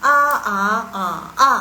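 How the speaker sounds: "A, a, a, a" each on its own Mandarin tone; four tones. Shown as a demonstration. Teacher showing students all four tones on A. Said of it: "itu yang cepatnya".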